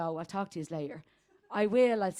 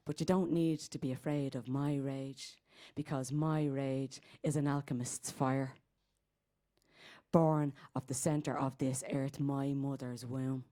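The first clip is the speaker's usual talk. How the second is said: low, measured poetic delivery; spoken-word incantation; 6 semitones lower, 5 dB quieter than usual. Acted. Reassuring listeners about her rage, in a low incantation. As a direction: recite it low and solemn.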